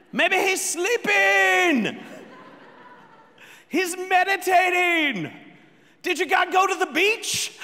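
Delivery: high, drawn-out heckling call; trash-talking taunt. Acted. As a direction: jeer at rivals from afar. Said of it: "He's a trash talker".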